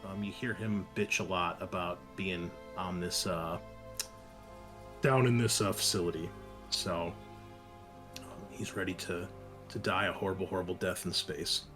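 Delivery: ordinary talk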